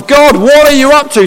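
high, loud mock appeal to God; humorous exasperation. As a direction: playfully call God out. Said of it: "Sometimes humorously we ask that question".

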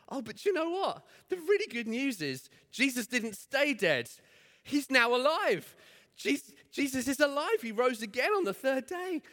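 excited reassurance. Retold or imitated. Grandma voiced reassuring girl.

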